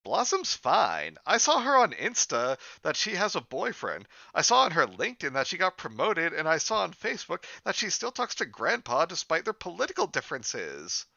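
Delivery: high-pitched, swooping cartoon-girl voice; high girlish script-reading voice, dropping at the end; Bubbles. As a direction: pitch it way up, loud and sing-song, for a girl character.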